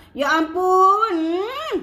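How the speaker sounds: drawn-out, high-pitched swoon over the taste; food-review rapture. Shown as a demonstration. Showing followers how to film a tasting, rapturous. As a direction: stretch it out, then a high savouring hum. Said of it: "Contoh buat video macam tu".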